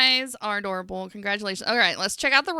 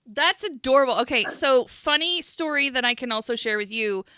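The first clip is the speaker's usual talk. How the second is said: normal talking voice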